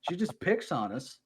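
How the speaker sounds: mock-aggrieved grumble; sarcastic complaint. Podcast co-host piling up joke charges against a fellow player, mock-aggrieved. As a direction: complain like a pretend victim. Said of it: "We need a sarcasm overload".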